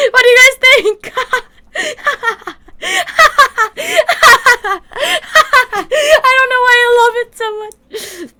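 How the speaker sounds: high, squealing through real laughter; helpless laughter breaking into the words; laughing till crying. Real, not acted. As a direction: squeeze the words out while cracking up. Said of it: "I'm crying".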